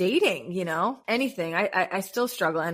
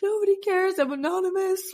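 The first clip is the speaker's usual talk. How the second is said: high, put-on voice of not caring; airy mock shrug of a voice; squeaky breezy self-mockery; mock carefree; 12 semitones higher than usual. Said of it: "I was just like".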